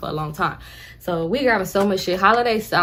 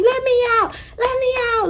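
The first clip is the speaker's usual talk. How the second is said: high pleading aunt. Retold or imitated. Aunt demands out.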